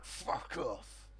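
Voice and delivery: low, hushed growl; grumbled. Retold or imitated. Ron grumbling a hushed curse at his neighbour. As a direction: spit it under your breath. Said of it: "he'd be like".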